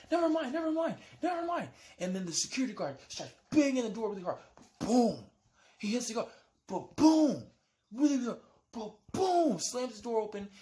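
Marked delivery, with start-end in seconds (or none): 0.1-1.7 hurried shout to get rid of him; girls brushing off. Retold or imitated. Girls shout at the guard to go away, high frantic. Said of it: "They're like". 4.8-9.6 loud, high mock boom of a door. Imitates the guard slamming the door, loud mock boom.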